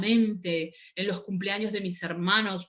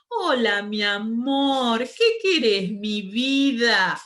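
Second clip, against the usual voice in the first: mum's tender shop voice; 2 semitones higher, 5 dB louder, much slower than usual. Retold or imitated. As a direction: coo at the little kids like her mother did. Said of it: "Me sale igual".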